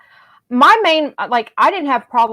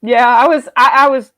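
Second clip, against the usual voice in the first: normal talking voice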